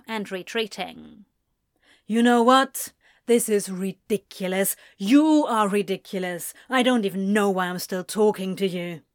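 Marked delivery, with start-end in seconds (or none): 2.1-9.0 scoffing. Acted. Anthony scoffing, exasperated.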